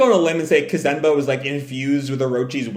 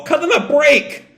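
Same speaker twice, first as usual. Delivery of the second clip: much higher pleading shout; calling out; 12 semitones higher, 3 dB louder than usual. Retold or imitated. Crews begging Big Mom, high pleading shout. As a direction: yell up at her. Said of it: "their crews call".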